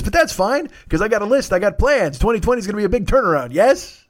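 sarcastic sunny spin on disaster; mock cheerful. Real, not acted. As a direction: sell the false hope with a grin. Forced optimism about doom.

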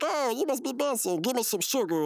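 high put-on greeting; hug-happy brother. Newcomer asking the boss for a hug, high mock friendliness. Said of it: "And he's like".

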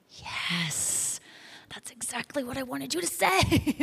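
soft breathed yes, then a squeezed, excited rise; thrilled but held in, as if finally hearing it; hushed delight. Retold or imitated. God hears the answer he wanted, hushed delight.

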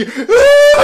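very high, loud wail; mock bawling. Child in front starts crying, imitated high wail. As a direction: burst out bawling like a child, laughing underneath. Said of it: "하고 막 우는 거야".

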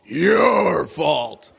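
slow, loud furious accusation; accusing shout. Acted. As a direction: hurl the blame at him, slowly. Wife points and accuses him.

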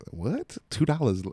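retold incredulous question. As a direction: react as if the haggle is absurd. Retells disbelief at the haggling.

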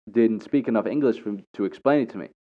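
usual speaking voice

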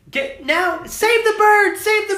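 high, loud mock shriek of his wife; imitating wife screaming. Husband mimicking his screaming wife, shrill-pitched mock panic. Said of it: "Jordan screaming behind me".

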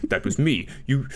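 stammering, confused Prime Minister; Boris Johnson. Acted. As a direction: stumble through it in baffled disbelief. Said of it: "do your fucking Boris Johnson accent".

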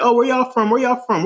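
high, eager questioning; curious tourists. Retold or imitated. Tourists getting acquainted in line, curious high voice. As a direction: imitate a friendly stranger, pitched well up. Retelling tourists asking around.

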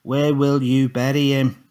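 slow, deliberate, loud and clear; repeating the question slowly for Tommy. A narrator's reading. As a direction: spell it out word by word. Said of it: "more clearly".

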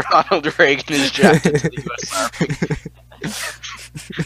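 high laughter running through the words; laughing. Real, not acted. Friend repeats the reader's slip, laughing hard. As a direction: crack up while repeating the line.

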